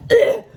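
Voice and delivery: mocking a loud, high disgusted ugh; offended ugh. Drunk woman storming off with a loud disgusted ugh. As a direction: scoff in outrage, loud and high. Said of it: "And she goes".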